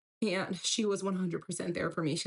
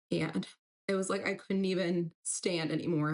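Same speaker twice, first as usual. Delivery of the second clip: usual speaking voice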